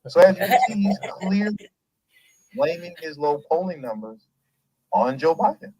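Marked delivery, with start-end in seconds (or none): none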